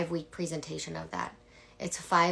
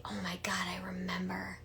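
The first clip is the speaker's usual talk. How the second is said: breathy hushed awe, barely voiced; hushed whisper; 8 dB quieter than usual. Coach whispering to her audience, hushed. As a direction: whisper it like a sudden revelation.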